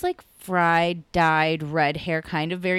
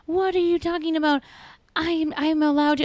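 high-pitched mock protest; indignant kooky lady; 10 semitones higher than usual. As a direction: imitate an affronted neighbor way up high. Kooky lady protests being scolded.

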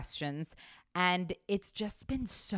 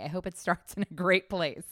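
ordinary talk

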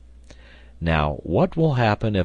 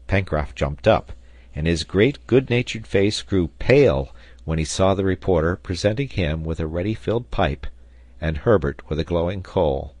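plain reading voice

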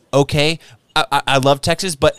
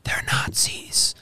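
slow, breathy comic stage whisper; mock-secret whisper of a punchline; 9 dB quieter, much slower than usual. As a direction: hush it like a dirty secret. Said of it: "Gotta do the whisper".

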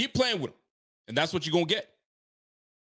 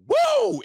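excited woo; 7 dB louder than usual. Real, not acted. Celebrating the comeback.

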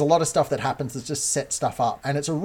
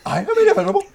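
high, sped-up Thanos line; chipmunk-pitched villain; 4 dB louder, much faster than usual. Pretend. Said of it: "I can hear Thanos talking now at that speed".

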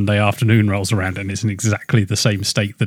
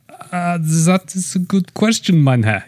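a hint of a German accent, slow and drawn out; a half-hearted German accent creeping into the line; mock German accent; 7 semitones higher, slower than usual. Asked for a French accent, drifting into a light German accent. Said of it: "if you tried for a French accent".

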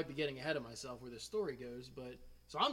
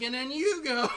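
high, loud, laughing mock indignation; worked-up, incredulous jab; exasperated teacher; 10 semitones higher, 12 dB louder than usual. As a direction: imitate a teacher's comic outrage. Teacher's exasperated reaction continues.

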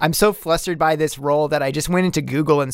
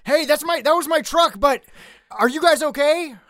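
high soothing call; 8 semitones higher than usual. Acted. [name] calming fleeing guys.

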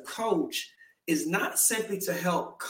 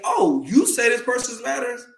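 higher, louder challenging outburst; challenging call-out; 7 semitones higher, 5 dB louder than usual. Retold or imitated. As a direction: throw the question back at them. Challenging critics in a quote.

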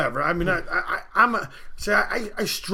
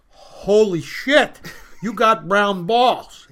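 drawn-out, louder mock astonishment; astonished doctor; 3 semitones higher, 5 dB louder, much slower than usual. Doctor seeing his patient's injury, mock astonished. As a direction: slowly let the shock land on each word.

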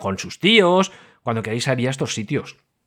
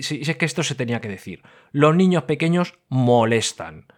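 ordinary talk, nothing marked

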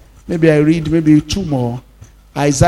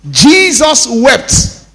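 very slow, higher, louder; dramatic slow; 6 semitones higher, 3 dB louder, much slower than usual. Acted. Pastor dramatising the verse for the church, very slow.

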